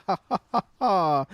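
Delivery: high, hammy chuckle of a smug prankster; mock laughter. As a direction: laugh like you're pleased with your own joke. Mocking a smug localizer.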